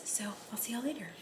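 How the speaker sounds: quick unvoiced whisper goodbye. Real, not acted. Whispering a quick goodbye to viewers as she leaves. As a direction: breathe the sign-off, fast and hushed.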